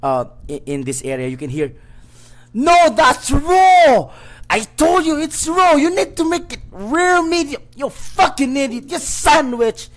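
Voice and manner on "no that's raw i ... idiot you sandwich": furious screaming chef, much higher and louder; a kitchen tyrant screaming at his staff, furious shout; angry chef shout. Acted. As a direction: scream at the cook like a raging chef. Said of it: "energetic but very angry voice".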